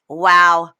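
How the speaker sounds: mock-sweet goodbye dripping with contempt; sarcastic brush-off. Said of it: "looks at him and goes".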